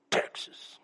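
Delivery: "Texas" breathed out soft and unvoiced, full of pride; proud breathy Texas. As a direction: savor "Texas" like a secret treasure. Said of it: "Texans are the only ones that say".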